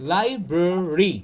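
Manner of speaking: slow, low লাইব্রেরি as "lai-brr-ree"; syllable by syllable লাইব্রেরি, "brr" in the middle; correct pronunciation. Shown as a demonstration. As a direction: swallow the middle vowel in লাইব্রেরি. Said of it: "ঠিকঠাক উচ্চারণ কী হবে".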